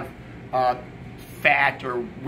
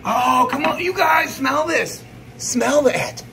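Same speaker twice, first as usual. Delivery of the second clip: high, loud, drawn-out delight; excited gushing; 9 semitones higher, 4 dB louder, slower than usual. Real, not acted. Cook urging the crew to smell the eggnog, excited gushing. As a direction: crow over it, voice soaring.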